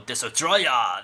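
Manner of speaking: "Desotroya" with an extra o after Des; imitating wrong pronunciation. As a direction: mimic the nerd saying "Desotroya". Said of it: "that's how he says it".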